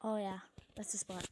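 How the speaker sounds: ordinary talk, nothing marked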